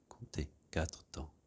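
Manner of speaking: slow whispered count; slow soft whispered instruction. Acted. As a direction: whisper it slowly, barely there. Counting breath holds in meditation.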